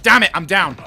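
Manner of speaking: loud panicked shout; frustrated yell. Real, not acted. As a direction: yell as you get shot down. Sudden firefight, player goes down.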